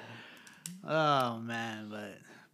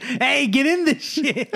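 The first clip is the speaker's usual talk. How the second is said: higher, slower imitated holler; mock rallying call; 7 semitones higher, slower than usual. Imitating a friend summoning the others to join the teasing, mock rallying call. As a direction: holler everyone over, playfully exaggerated.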